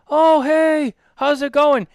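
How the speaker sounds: high, loud breezy greeting in a put-on voice; imitating Jose. Imitating Jose greeting him at the airport, breezy put-on voice. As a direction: mimic a friend's casual hello. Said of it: "you go".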